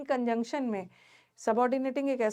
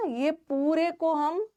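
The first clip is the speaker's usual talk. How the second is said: slow emphasis; slower than usual. Shown as a demonstration. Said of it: "teacher sums up slowly".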